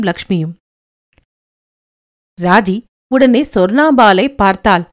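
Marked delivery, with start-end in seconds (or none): none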